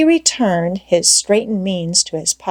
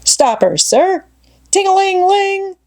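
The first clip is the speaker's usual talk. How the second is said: higher, louder shouted captain's order with a bell ring; boy playing captain; 12 semitones higher, 4 dB louder than usual. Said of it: "giving the orders and executing them".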